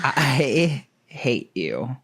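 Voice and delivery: very slow, drawn-out mock hatred; mock loathing. Bakery worker to pedantic customer, slow mock loathing. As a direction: stretch each word in exaggerated disgust.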